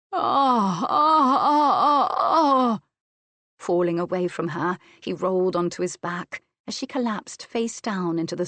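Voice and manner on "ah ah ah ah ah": high, loud repeated cries of release; loud climax groans. A narrator's reading. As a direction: cry out in rising pleasure. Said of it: "with a loud groan".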